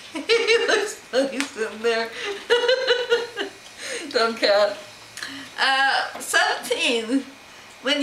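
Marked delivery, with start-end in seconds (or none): 2.1-3.5 laughing. Real, not acted. Laughing at the cat.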